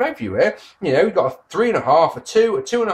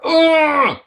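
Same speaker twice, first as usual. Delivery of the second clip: frustrated groan; 11 semitones higher, 5 dB louder than usual. Retold or imitated. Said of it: "it's like".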